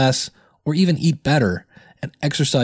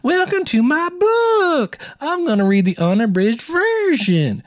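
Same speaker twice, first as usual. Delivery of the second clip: much higher and slow, the words stretched into long flat held notes; hammed-up sing-song reading of a book intro; imagined Ruby audiobook voice; 13 semitones higher, slower than usual. Podcaster imagining Ruby reading her own audiobook, high drawn-out sing-song. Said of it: "I could hear it going".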